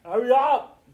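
high, loud hurry-up call; urgent host. Acted. Host urging contestants to hurry, loud. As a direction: rush them like a game host.

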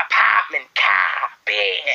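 put-on American-accent word list, dropped r's. Practising American accent words for viewers, mock East Coast. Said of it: "Someone said do American accents".

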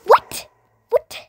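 loud high disbelieving what; shocked shout. Real, not acted. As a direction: burst out in shock. Shocked outburst.

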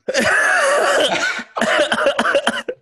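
loud squealing laugh; high rising laugh. Real, not acted. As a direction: burst out laughing. Laughing at power hour idea.

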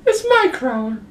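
high, slow whiny princess voice; sing-song little princess; cartoon princess. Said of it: "talk like a princess".

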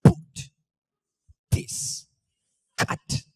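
"Boot. This. Cut." as separate unvoiced drum hits; beatbox pronunciation. Shown as a demonstration. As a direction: make "Boot", "This" and "Cut" percussive, barely voiced. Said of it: "say it like this".

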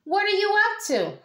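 high motherly pig voice; mama pig asking. A narrator's reading. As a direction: ask like a curious mama pig. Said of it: "the mama pig asked".

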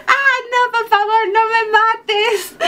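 high, slow, whiny begging; hammed-up plea to the chainsaw killer; mock pleading. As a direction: beg for your life like a cartoon victim. Begging Jason not to kill her.

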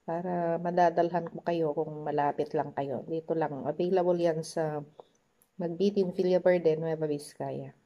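chicken at the lips; talking while eating. Real, not acted. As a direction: mouth partly full. Seen 0: holds chicken at lips, biting between words throughout labelled span.

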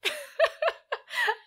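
real burst of laughter breaking character; laughing. Real, not acted. Cracking up mid-scene at the old interview. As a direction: laugh out loud, high.

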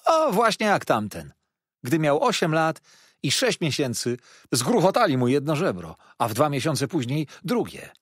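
high, loud horrified relish; dramatic waiter. A narrator's reading. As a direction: pile on the grisly details to frighten the guest. Waiter continues grim tale.

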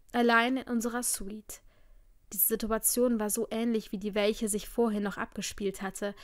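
ordinary delivery

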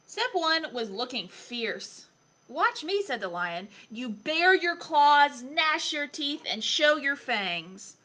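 put-on lively, expressive story reading for a young child; picture book reading. A narrator's reading. Adult reading Lion Lessons to a child, animated storytelling. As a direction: read it aloud with playful emphasis.

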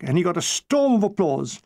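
usual speaking voice

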